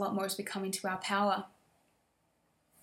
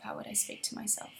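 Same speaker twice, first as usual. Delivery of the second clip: a whispered echo of the question; 10 dB quieter than usual. Shown as a demonstration. Repeating it to herself in a whisper. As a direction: let it fall to a breath, as if inward.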